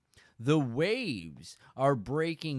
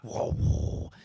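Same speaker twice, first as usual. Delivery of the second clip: breathy whoa; 5 dB louder than usual. Acted. Pulling in to the dock.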